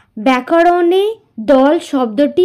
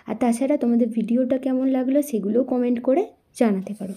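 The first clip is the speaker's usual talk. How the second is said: quieter, quick channel plug; promo read; 7 dB quieter than usual. Acted. Teacher reading the channel promo to students, softer. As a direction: rattle off the ad for the website.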